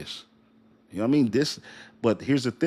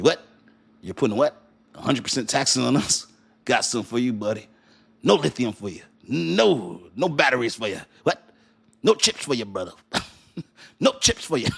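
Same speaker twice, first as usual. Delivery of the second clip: mock China comeback. China voiced mocking Trump tariffs.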